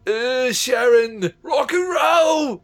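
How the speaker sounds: hammed-up Australian accent, high, very slow and drawn out; a wailing Australian caricature, yelled out slow; over-the-top Australian accent stretched into a high, loud cry; mock Australian accent. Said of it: "the Aussies speak like this".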